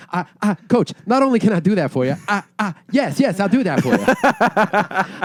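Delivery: Bobby Boucher voice. Acted. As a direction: play the eager, simple water boy answering his coach. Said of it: "Can you be the Water Boy too?".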